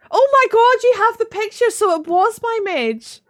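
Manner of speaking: high, loud exclamation; excited outburst. Real, not acted. Streamer finding out someone has the picture, excited. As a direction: burst out, gradually slowing at the end.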